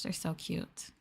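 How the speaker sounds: usual speaking voice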